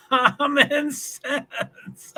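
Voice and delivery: drawn-out, high laughing read. Real, not acted. Host reading a chat comment aloud, laughing. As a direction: stretch it out through the laughter.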